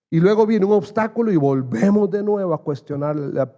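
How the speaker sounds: impassioned pulpit preaching; preaching at full force. Real, not acted. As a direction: drive it home to the congregation.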